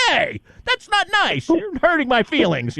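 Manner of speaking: high, hammed-up hurt-feelings whine; high-pitched mock complaint. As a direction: play yourself as a wounded child. Host mocking his own thin skin.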